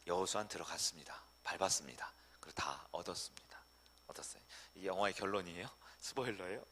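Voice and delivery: fading to a soft whisper. Real, not acted. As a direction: let it fade to a hushed whisper. Whispered climax of story.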